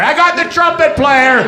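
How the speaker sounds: high, loud battlefield dibs shout; acted triumphant claim, yelled; eager calling-out. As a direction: call it out across the battlefield.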